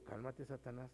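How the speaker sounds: softly muttered "Cálmate, Satanás"; soft inner rebuke. Retold or imitated. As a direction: say it under your breath. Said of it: "yo por eso digo". ("Cálmate, Satanás" dicho muy bajo, para uno mismo)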